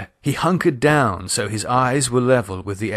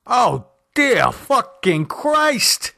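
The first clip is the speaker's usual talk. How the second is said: high, slow drawn-out shocked shout; husband realising it is a bug, shocked shout; 12 semitones higher, much slower than usual. A narrator's reading. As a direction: let the curse ring out slowly. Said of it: "shouted Henry".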